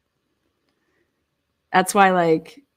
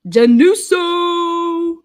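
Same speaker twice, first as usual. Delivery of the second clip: much higher, slow, drawn-out name; mock Italian flourish; 10 semitones higher, 8 dB louder, much slower than usual. Reading court papers, slow theatrical drawn-out name.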